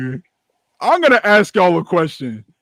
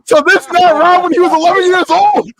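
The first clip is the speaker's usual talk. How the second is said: laughing tease; 9 semitones higher, 3 dB louder than usual. Real, not acted. Repeats the tease while laughing.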